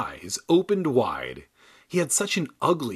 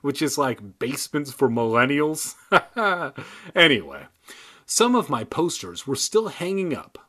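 ordinary delivery

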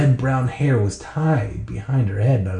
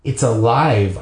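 ordinary delivery, nothing marked

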